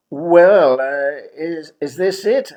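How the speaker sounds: high, loud, incredulous; stunned "is this it"; dismayed question. Retold or imitated. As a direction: ask in disbelief at the bare room. Shocked at empty flat.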